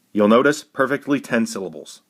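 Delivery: normal talking voice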